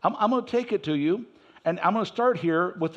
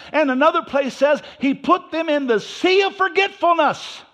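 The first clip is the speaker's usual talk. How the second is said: loud, high preaching to the congregation; full-force preaching; 7 semitones higher, 5 dB louder than usual. Acted. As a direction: drive the point home, higher and louder.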